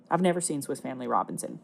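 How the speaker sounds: deadpan confession. Real, not acted. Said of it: "Again, I say to thee".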